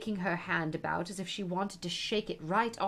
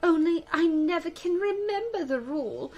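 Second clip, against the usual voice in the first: higher, loud, emphatic Queen; wistful Queen; 7 semitones higher, 6 dB louder than usual. A narrator's reading. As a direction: lament in the Queen's raised voice. Queen voiced in story.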